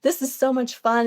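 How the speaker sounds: put-on high, gushing excited little kid; really excited. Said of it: "she would get really excited".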